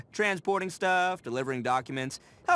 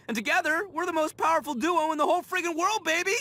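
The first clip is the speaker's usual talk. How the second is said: higher, rising triumphant cheer; triumphant hype; 9 semitones higher than usual. Acted. Ito hyping up his duo with the Traveler, triumphant. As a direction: crown the duo like a champion.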